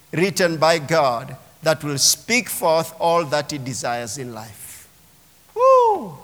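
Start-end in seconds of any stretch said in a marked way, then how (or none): 5.5-6.2 high joyful whoop. Real, not acted. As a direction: let out a high cry of joy. Worship cheer after the sermon point.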